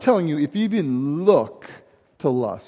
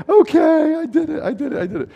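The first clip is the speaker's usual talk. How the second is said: giving in, laughing; 10 semitones higher than usual. Retold or imitated. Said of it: "the guy would be like".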